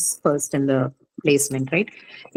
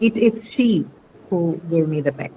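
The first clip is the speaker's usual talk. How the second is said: "she" set apart by a pause, the rest quicker and lower; emphasis on she; 3 semitones lower, much faster than usual. Shown as a demonstration. Teacher demonstrating emphasis on the subject, stress on "she". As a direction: hit "she", pause, then run lower through the rest. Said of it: "This is laying emphasis on the subject".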